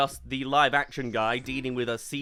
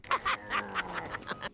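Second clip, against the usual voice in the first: breathy laugh; 7 dB quieter than usual. Real, not acted. Joke about the cat pun.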